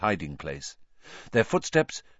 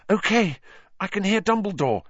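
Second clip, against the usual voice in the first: tense; 10 semitones higher than usual. A narrator's reading. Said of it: "said Hermione tensely".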